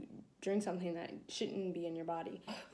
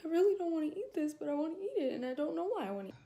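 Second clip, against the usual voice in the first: high, whispered and rushing; half-whisper, near tears, words tumbling out; emotional high whisper; getting emotional; 8 semitones higher, much faster than usual. Retold or imitated. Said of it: "I start getting kinda emotional".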